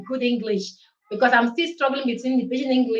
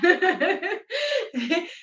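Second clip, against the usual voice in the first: high giggle; laugh; 8 semitones higher than usual. Real, not acted. Laughing at her own Italian. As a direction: giggle at yourself. Laughs after announcing lesson.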